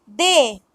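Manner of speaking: de with a pure e vowel; Spanish letter D. Shown as a demonstration. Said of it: "D is pronounced as".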